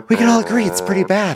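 high, emphatic singsong declaration; mock outrage. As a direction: push it up high for comic emphasis. Playful mock breaking-news bit.

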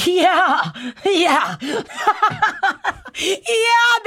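high, laughing yeahs; real laughter breaking through. Real, not acted.

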